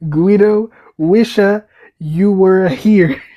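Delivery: put-on loud, slow, drawn-out Italian accent. Reading the postcard from Guido's Italian cousin, Italian accent. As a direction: stretch each word with a put-on Italian accent.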